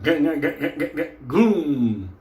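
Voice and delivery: stuttering cranking noise, then a rumble; vocal sound effect of a car starting; imitated engine starting. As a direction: crank in quick pulses and let it catch.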